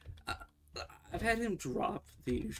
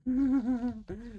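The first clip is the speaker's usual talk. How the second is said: burst of laughter; 10 semitones higher, 6 dB louder than usual. Real, not acted. Laughs at clone mistake.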